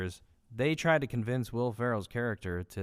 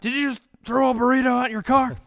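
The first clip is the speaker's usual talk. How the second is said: high, fast, shouted disbelief; loud incredulous; 12 semitones higher, 8 dB louder, faster than usual. Retold or imitated. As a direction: yell it in disbelief. Quoting Anchorman, loud.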